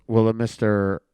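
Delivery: loud, slow paging call over a speaker; lounge paging announcement. Retold or imitated. Lounge attendant pages a guest, loud and drawn out. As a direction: page the guest to the front desk. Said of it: "the guy over the phone goes".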